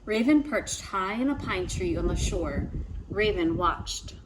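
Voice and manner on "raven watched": ordinary delivery, nothing marked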